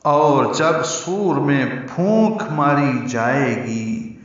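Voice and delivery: slow, grave reading of the meaning; solemn translation reading. Acted. Teacher solemnly reading the verse meaning to students. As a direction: read the meaning weightily and slowly.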